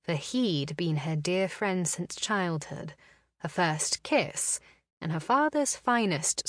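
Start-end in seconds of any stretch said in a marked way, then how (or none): none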